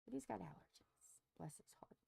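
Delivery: soft whispered aside about him. Real, not acted. Telling listeners about Jimmy's allergies, a whispered aside. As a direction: lean in and whisper it.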